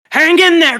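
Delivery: high, loud encouragement; urgent call. Acted. Father urging Colin to hold on, urgent. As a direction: call out to a child in danger. Father calls to child, acted.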